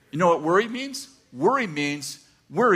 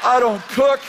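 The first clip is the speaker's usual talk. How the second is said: loud, higher comic outburst; exasperated outburst; 7 semitones higher, 6 dB louder than usual. Retold or imitated. Husband fretting at home without his wife, exasperated outburst. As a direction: blurt it out louder and higher. Comic self-pity rant while retelling panic.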